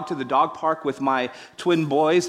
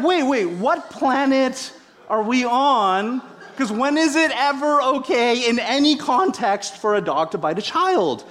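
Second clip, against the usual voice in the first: incredulous retort; 8 semitones higher than usual. Retold or imitated. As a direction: play the stunned parent asking what planet they are on. Retold incredulous reply to owner.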